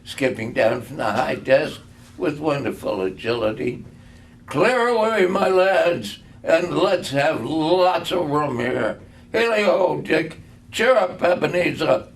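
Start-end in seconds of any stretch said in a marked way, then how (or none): 4.5-12.1 jovial Fezziwig. A narrator's reading. Fezziwig jovially orders clearing.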